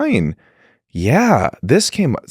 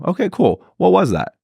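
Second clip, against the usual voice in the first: curious surprise; 9 semitones higher than usual. Retold or imitated. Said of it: "she goes".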